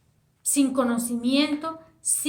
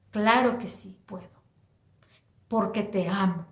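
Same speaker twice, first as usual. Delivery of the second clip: whispered, a touch lower; gentle whisper; 4 semitones lower than usual. Acted. God reassuring the sinner, whispered tenderly.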